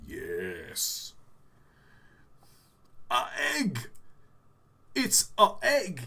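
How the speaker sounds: drawn-out word, then a high punchy exclamation; mock presenter's emphatic delivery; Jeremy Clarkson. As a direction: pause big, then pronounce it with pomp. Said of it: "He goes ... the way he talks".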